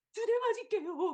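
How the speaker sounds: put-on high, loud nervous kid's offer; timid child. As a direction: bargain like a scared child. (눈치 보는 아이처럼 높고 크게)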